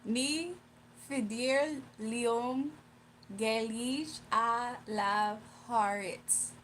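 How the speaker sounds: "Ni fidir leon gelish a la harit" sounded out very slowly; slow Irish phrase attempt. Shown as a demonstration. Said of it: "I tried to tell him I can't speak Irish".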